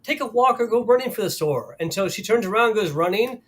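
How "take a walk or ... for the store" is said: higher, livelier presenter quote; mock demo-video enthusiasm; imitating upbeat pitch. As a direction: pitch it like a cheery fitness tip. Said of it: "talking about".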